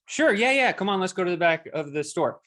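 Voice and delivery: an overeager salesman who smells a sale; eager salesman. Retold or imitated. Eager salesman jumps at the request.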